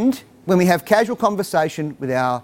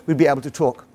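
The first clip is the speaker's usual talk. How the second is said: ordinary talk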